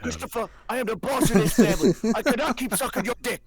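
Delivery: high, loud, fast squeaky mobster voice; squeaky mock Tony. Friends riffing on Sopranos, a squeaky mock Tony. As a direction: squeak it out like a cartoon mob boss. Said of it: "a squeaky voice".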